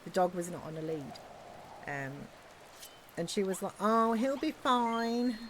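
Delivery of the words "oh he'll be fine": slow, drawn-out whine; mock the dog owner, whining slowly; whiny voice. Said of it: "I always do those whiny voices".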